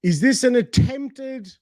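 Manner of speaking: normal talking voice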